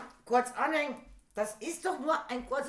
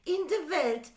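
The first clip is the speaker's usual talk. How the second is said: mock Russian woman, higher; high hammy Russian accent; russian accent in german; 8 semitones higher than usual. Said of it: "Ich als Tatjana sag".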